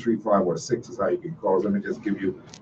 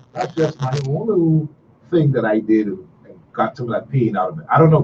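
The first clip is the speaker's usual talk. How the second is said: usual speaking voice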